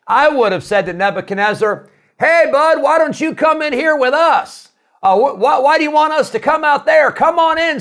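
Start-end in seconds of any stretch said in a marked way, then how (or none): 2.2-7.8 high, loud mocking dare; sarcastic taunt. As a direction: taunt the king to come inside, hamming it up. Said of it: "a little sarcastic".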